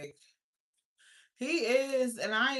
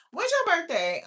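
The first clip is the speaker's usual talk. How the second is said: calling out demand; 9 semitones higher, 8 dB louder than usual. Real, not acted. Demanding Terrell's birthday.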